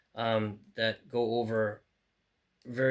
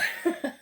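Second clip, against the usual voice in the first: short laugh. Real, not acted. Laughs again.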